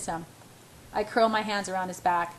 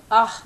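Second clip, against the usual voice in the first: a sudden gasp of pleasure, louder; sharp gasp; 2 semitones higher, 8 dB louder than usual. Acted. She gasps at his touch in bed, sharp gasp. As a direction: let the breath catch out loud. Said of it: "I gasp".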